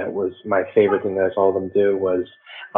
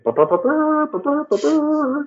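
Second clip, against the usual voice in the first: high sung trumpet blast, ba ba ba; mock trumpet fanfare. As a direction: hum a royal fanfare.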